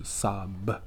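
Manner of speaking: "傻b" as "sob", closed with a b; blended word. Shown as a demonstration. Teacher adds the final letter, fully blended "sob". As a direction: finish "傻b" on a released b. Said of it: "后面再加上b".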